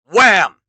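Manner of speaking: very loud hit; wham effect. A narrator's reading. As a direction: slam it. Impact effect.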